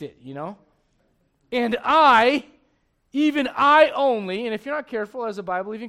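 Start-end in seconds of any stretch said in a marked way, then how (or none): 1.5-4.5 loud, high, slow self-pitying cry; Elijah's loud lament. Retold or imitated. Elijah crying out to God that he alone is left, loud and slow. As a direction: stretch the I out in self-pity.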